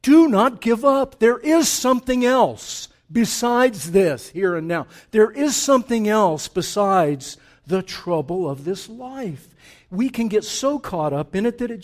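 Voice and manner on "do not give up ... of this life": loud, high-pitched preacher's plea; urgent exhortation. Acted. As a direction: urge them forcefully to hold on. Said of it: "and he's urging us".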